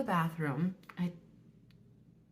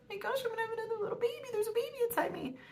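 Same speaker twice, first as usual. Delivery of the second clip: much higher, fast, giddy delight; giddy excitement; much faster than usual. Retold or imitated. Mom thrilled about another little baby, giddy and fast. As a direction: gush it out like squealing over a baby.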